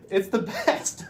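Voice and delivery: high, loud and delighted; gushing. Real, not acted. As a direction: gush about it with a laugh. Gushing about the month of September.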